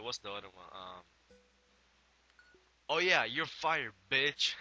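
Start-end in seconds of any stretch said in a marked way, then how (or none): 2.9-4.5 loud, slow, higher mock yell; mock firing shout. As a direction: bellow it like a fake boss firing someone.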